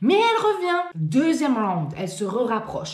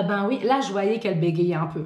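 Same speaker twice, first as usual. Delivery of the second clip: ordinary talk, nothing marked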